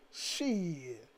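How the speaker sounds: lightly voiced cool-guy "hell yeah"; odd put-on voice. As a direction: slip into a goofy voice for a moment. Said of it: "I don't know why I'm talking like that".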